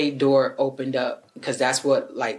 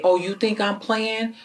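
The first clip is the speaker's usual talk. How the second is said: raised pitch, imitating a threat; mock angry yell; 7 semitones higher than usual. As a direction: retell his yelling, higher. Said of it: "he started yelling again".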